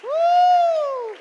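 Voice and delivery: full-voiced high shout of praise. Real, not acted. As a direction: let it rip, loud and high. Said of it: "Let us shout hallelujah".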